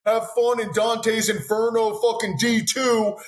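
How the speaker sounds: high, slow sneering mock farewell; mocking coaches. Coaches mocking banished players, high slow sneer. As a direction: taunt them like a gloating coach. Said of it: "They're like".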